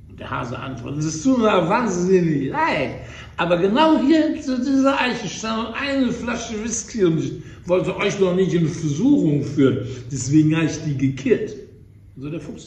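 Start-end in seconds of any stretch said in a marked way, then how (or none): 1.0-11.6 tipsy hare. Acted. Drunk hare excuses himself.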